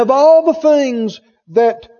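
ordinary talk, nothing marked

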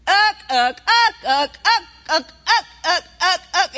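the inner alarm pushing hard; loud uck. Shown as a demonstration. Preacher voices the inner warning before a trip, loud. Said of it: "something inside you keeps going".

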